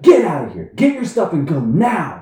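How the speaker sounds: loud, high staged firing shout; storming manager yell. Retold or imitated. Manager publicly fake-firing employee, furious shout. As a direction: bellow the order for the whole room. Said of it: "The general manager comes storming out".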